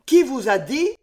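high, loud startled outburst; frightened cry. A narrator's reading. Wounded count startled the stranger knows his plans, frightened cry. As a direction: blurt it out in alarm. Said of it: "s'écria le comte du Barry épouvanté".

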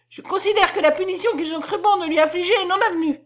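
high, loud falsetto; falsetto cry. A narrator's reading. Count ruling the punishment void, crying out in falsetto. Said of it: "cria ces derniers mots avec sa voix de fossé".

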